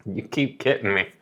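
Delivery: ordinary talk, nothing marked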